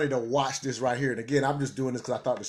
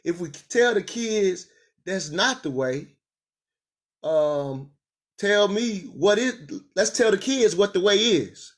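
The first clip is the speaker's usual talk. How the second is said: heated retort; 5 semitones higher, 4 dB louder than usual. Real, not acted. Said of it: "I say".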